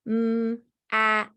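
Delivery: "mm" and "at" each said as separate sounds; tapping out sounds. Shown as a demonstration. Teacher showing step four, tapping out sounds separately. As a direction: tap out "mm" then "at" slowly. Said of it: "I would tap out the sounds".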